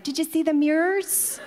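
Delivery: high, eager mock-child voice; like little kids. Dad and daughter in the back seat, imitating little kids. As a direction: pipe up like a kid in the back seat. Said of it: "like two little kids".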